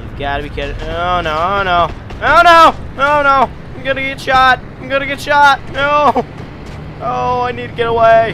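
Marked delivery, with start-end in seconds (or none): 2.2-3.5 high, loud panicked cries; yelling in panic as bullets fly. Real, not acted. Panicking in a game under fire. 3.7-4.6 panicked yell. Real, not acted. Panicking in a game under fire. 4.6-5.6 panicked yell. Real, not acted. Panicking in a game under fire. 5.7-8.3 high, loud panicked cries; yelling in panic as bullets fly. Real, not acted. Panicking in a game under fire.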